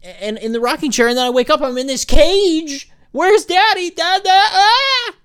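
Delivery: put-on a scared baby waking up in the crib, wailing; panicking baby. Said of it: "they start to freak out".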